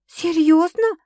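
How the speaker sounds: high, loud incredulous cry; exclaiming. A narrator's reading. Sasha challenging Stas, exclaiming. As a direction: throw the word out, arms wide. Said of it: "воскликнула Саша".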